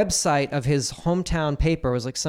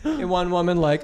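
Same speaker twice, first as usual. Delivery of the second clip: usual speaking voice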